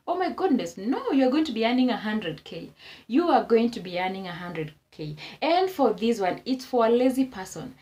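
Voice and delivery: ordinary talk, nothing marked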